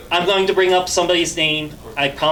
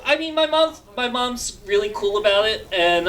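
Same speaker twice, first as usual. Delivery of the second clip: usual speaking voice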